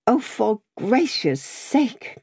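high, drawn-out exasperated wail, understated; distraught crying. A narrator's reading. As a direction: wail it slowly, mildly tearful. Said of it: "still wildly crying".

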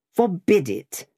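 solemn Cockney pronouncement; added solemnity. A narrator's reading. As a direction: hold forth gravely. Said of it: "with additional solemnity".

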